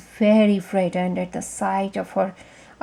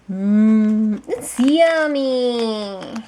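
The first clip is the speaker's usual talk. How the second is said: put-on slow, savoring child voice; drawn out with relish; 2 semitones lower, much slower than usual. Goldilocks savoring porridge.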